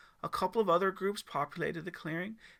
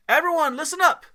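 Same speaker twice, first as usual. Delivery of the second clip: loud high call to the group; yelling; 12 semitones higher, 14 dB louder than usual. Acted. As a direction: shout to gather the scouts. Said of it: "Travis yelled".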